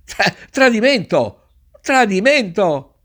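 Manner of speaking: grido allarmato, sempre più alto; alarmed shout. Acted. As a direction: urla al tradimento, indignato e acuto. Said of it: "si mise a gridare". (grido di allarme acuto e forte)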